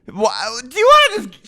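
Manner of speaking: high, loud, boozy sing-song; drunk Miranda. Retold or imitated. Miranda urging friends to split a bottle, loud boozy voice. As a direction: push the whole bottle on everyone with a big tipsy voice. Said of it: "Miranda goes".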